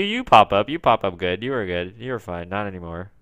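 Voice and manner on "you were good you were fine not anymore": ordinary delivery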